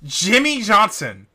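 mock angry rant. Real, not acted. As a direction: pile on the outrage. Friend ranting at rival.

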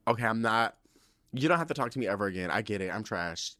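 ordinary delivery

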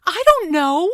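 loud, high, defensive retort; exasperated. A narrator's reading. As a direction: throw it back in frustration. Mother exasperated in quarrel.

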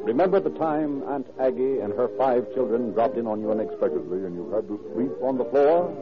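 usual speaking voice

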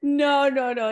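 laughing. Real, not acted. Laughing at the idea.

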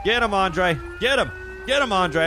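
high, urgent police shouts; shouting commands. Real, not acted. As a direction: yell orders at the suspect. Shouting commands at fleeing suspect.

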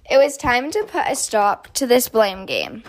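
plain reading voice

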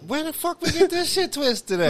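high-pitched disbelief with laughter in it; incredulous. Real, not acted. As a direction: squeal the question, baffled and amused. Co-host baffled by the misunderstanding.

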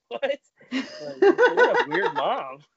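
high, loud and slow, laughing through it. Real, not acted. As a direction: laugh out the disbelief. Laughing at the mom story.

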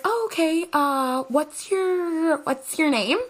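high, bright fake-casual questioning; high, chirpy mock politeness; fake breezy. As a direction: cover the panic with chirpy politeness. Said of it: "I'm like".

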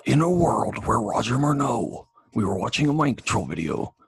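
lightly put-on movie trailer voice; a hint of a hammy trailer announcer; mock trailer announcer. Host introducing video to listeners, mock trailer narration.

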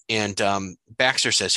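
ordinary talk, nothing marked

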